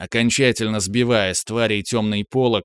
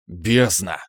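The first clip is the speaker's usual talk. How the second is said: low sharp curse; cursing; 3 semitones lower than usual. A narrator's reading. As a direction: spit out the curse. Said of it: "выругался я". (низкое резкое ругательство)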